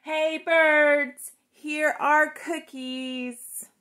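calling out loud and long to the birds; loud shout. A narrator's reading. Frog calling the birds outside, loud shout. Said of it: "He shouted in a loud voice".

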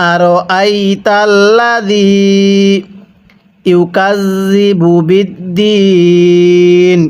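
বিদ্দিন drawn out very slowly; slow recitation. Shown as a demonstration. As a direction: stretch বিদ্দিন in chant. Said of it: "এরকমভাবে শিখব।". (বিদ্দিন খুব টেনে তিলাওয়াত)

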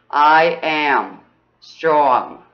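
ordinary talk, nothing marked

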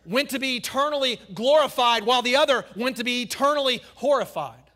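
loud, climbing pulpit delivery; full-force preaching. Acted. Pastor thundering at church about heaven and hell, at full force. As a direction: preach it hard to the congregation. Preacher drives home the contrast.

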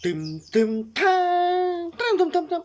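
vocal drum thuds, tum tum; mouth sound effect. Shown as a demonstration. Vlogger making his own drum sound effect for the viewer. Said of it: "อันนี้คือเรียกว่า sound effect".

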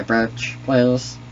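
ordinary delivery

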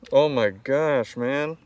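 normal talking voice

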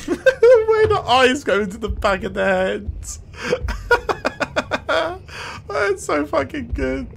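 high-pitched, laughing delight; cracking up. Real, not acted. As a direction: squeal it through real laughter. Cracking up at the character.

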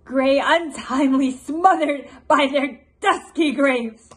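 loud, forceful tragic declamation; Queen Margaret. Acted. Vengeful queen listing the smothered dead, loudly declaimed. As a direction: proclaim the deaths at full voice. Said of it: "a portion of Queen Margaret's monologue".

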